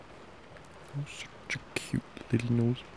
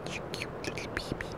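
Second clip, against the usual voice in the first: whisper; 9 dB quieter, faster than usual. Acted. Cooing over partner in bed.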